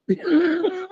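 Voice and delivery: high breathy giggle. Real, not acted. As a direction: laugh it off softly. Laughing at falling asleep.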